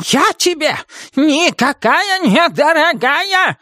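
high-pitched, drawn-out witch's yell; angry yelling. A narrator's reading. Witch rejects being called dear, yelling angrily. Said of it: "гневно закричала она".

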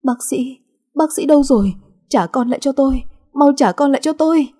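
hunting for the doctor in panic; a distraught mother demanding her child back; frantic pleading. A narrator's reading. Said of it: "miệng liên tục lẩm bẩm".

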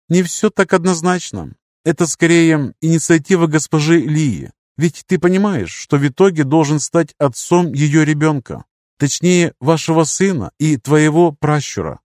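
gently lifted, courteous mentor. Acted. Lirius speaking.

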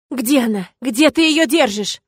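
almost scream at him; near shout. Acted. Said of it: "Едва ли не срываюсь на крик я".

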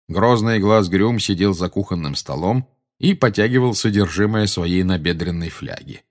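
plain reading voice

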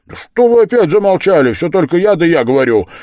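high, loud complaint; exasperated outburst. Acted. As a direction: burst out at the silent company. Exasperated outburst at silent company.

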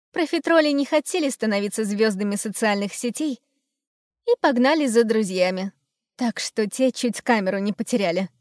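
plain reading voice

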